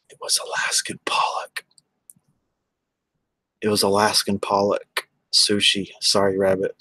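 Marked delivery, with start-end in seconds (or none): 0.1-1.7 whispered confession, no voice at all; hushed, like admitting a secret. Real, not acted. Whispering to the chat that the sushi was Alaskan pollock. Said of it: "sorry to say it was".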